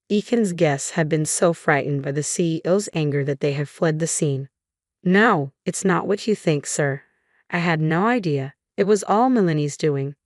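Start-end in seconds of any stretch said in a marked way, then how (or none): none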